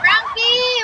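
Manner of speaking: loud high name call. Real, not acted. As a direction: shout the name happily. Calling out a viewer name. (masiglang tawag)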